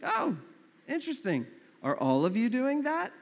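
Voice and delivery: high "oh" into rising, probing question; mock intrigued surprise. Suitor quizzing his date's roommates about husband lists, mock intrigued. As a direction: play up the nosy interest.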